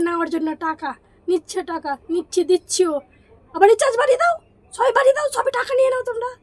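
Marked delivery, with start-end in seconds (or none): 3.5-6.3 high, loud, indignant mockery; sarcastic outburst. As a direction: goad them sarcastically at raised pitch. Sarcastic outburst at price hikers. (চড়া গলায় রাগী ব্যঙ্গ)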